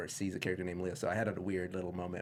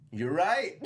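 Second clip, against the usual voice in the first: silly cartoon voice; 8 semitones higher, 6 dB louder than usual. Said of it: "he's like".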